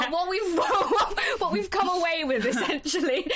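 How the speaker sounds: giggling while talking; laughing. Real, not acted. Amused talk about the book.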